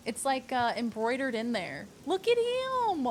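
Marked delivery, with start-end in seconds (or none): 2.1-3.1 high, drawn-out gushing squeal; cooing adoration. Real, not acted. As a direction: gush over something adorable, stretched and high. Cooing over embroidered wolf.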